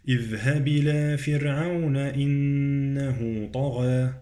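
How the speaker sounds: sustained recitation, thin r in "فرعون"; light ra. Shown as a demonstration. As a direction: keep the r of "فرعون" light, hum "إنه". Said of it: "مرقق الراء".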